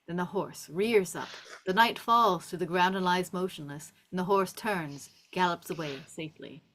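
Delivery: ordinary talk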